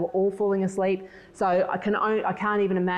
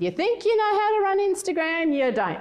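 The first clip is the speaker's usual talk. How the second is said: high-pitched mock criticism from a son; teenage put-down; 12 semitones higher than usual. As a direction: mimic a scornful kid, pitch way up. Acting teenage son's criticism.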